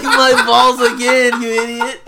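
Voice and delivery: slow taunt broken by laughter; laughing taunt. Retold or imitated. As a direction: gloat at the friend who fell for it again. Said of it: "I was like".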